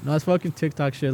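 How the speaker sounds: usual speaking voice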